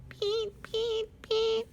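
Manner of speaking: very high chirping peeps; nighthawk call. Acted. Reading the book, imitating the nighthawk's call. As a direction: pipe it like a little night bird. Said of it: "A nighthawk calls out".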